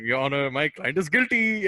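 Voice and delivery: put-on deadpan Indian accent in court. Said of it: "just do an Indian accent".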